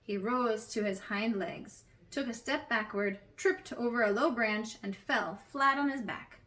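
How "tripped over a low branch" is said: ordinary delivery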